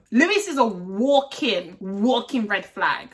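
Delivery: "walking" hit hard and said twice; stress on walking. Real, not acted. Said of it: "I emphasize the word walking".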